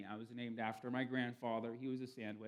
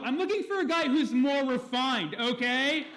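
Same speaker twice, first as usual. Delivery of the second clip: loud, high, laughing prissy brush-off; hammed-up snob, nose in the air; snooty girl; 10 semitones higher, 10 dB louder, slower than usual. Girl on the app rebuffs the sleazy guy, loud and high.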